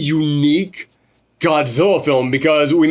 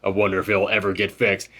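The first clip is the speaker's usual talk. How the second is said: put-on weary old man; 7 semitones lower, 6 dB quieter than usual. Host quoting Akio's bitter line, low and quiet. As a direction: sigh it out low like a tired veteran. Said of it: "Akio was like".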